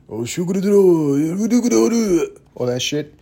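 loud, high mock Japanese gibberish; mock Japanese accent. Comedian imitating angry languages, mock Japanese accent. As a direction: spew fake Japanese, high and aggressive. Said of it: "The Japanese".

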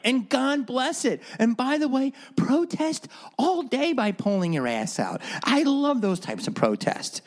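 usual speaking voice

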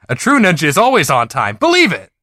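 high, loud anime ninja boast; punchy, high-pitched ninja declaration; Naruto catchphrase. Acted. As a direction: call it out like an excited cartoon hero. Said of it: "I Naruto ran as fast as".

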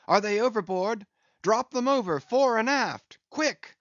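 high, loud shouted commands; urgent orders. A narrator's reading. Starbuck shouting orders at the crew, urgent and loud. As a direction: bark orders fast against the gale.

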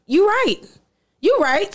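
high-pitched, giving in; conceding. Retold or imitated. Conceding to granny's imagined scolding.

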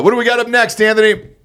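loud, high-pitched host call. Real, not acted. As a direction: holler it over to your co-host. Host calls out to co-host.

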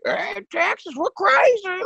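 high, hammed-up Texan crowd cheer; mock proud Texan. Comic imitates Texas crowds loving the joke, high mock cheer. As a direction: play a proud local cheering itself on. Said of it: "and they're just like".

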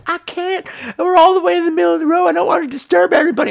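high, fast, fretful partner voice; a fussy, hurried excuse; high and rushed, the partner too polite to leave his seat; imitating partner. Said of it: "He's like".

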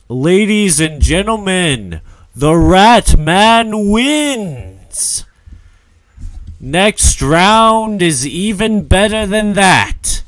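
high, booming ring announcer shout; arena announcer. Acted. Announcer to the gladiator crowd after a win, drawn-out hype shout. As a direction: call the fight loudly, stretching the big words. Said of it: "you guys can hear an announcer".

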